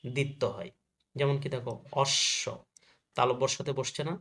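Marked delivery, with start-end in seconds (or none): 2.0-2.4 অশ্ব said osh-sho, the sh held; doubled শ. Shown as a demonstration. Teacher shows students ব-ফলা doubling, অশ্ব as osh-sho. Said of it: "তালব্যর স double হয়ে গেছে".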